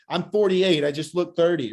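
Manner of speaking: usual speaking voice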